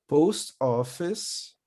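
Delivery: Post office said slowly, stress on post; slow careful model. Shown as a demonstration. Teacher slowly models Post office for students to repeat. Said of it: "Prestem atenção na pronúncia junto com o teacher".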